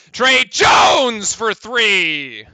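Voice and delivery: high, loud, slow drawn-out call; excited shout. Real, not acted. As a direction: stretch it out as the ball drops. Calling a three-pointer.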